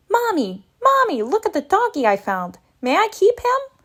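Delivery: put-on eager kid calling for Mommy, pitch leaping up; excited little-kid plea; pleading child. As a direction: beg hopefully, rising high on the question. Child begging mother to keep dog.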